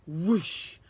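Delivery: loud rising whoosh; whoosh sound. Acted. Wand being waved, loud whoosh. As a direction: swish it like a wand.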